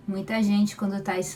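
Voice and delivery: usual speaking voice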